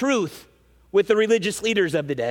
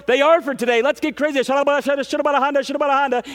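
higher-pitched parody of overexcited tongues-speakers; mock frenzy; 4 semitones higher, much faster than usual. Preacher mimics churchgoers going overboard on spiritual gifts, mock frenzy. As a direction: rattle it off in a high, crazed chant. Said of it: "they go nuts at church".

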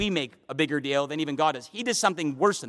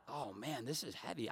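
burdened mutter; 6 semitones lower, 18 dB quieter than usual. Acted. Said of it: "I'm not like".

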